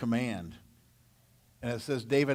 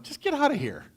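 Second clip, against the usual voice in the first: scared brush-off; 11 semitones higher than usual. Retold or imitated. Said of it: "they're scared, going".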